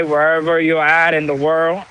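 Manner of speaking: usual speaking voice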